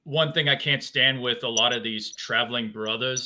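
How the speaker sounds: ordinary delivery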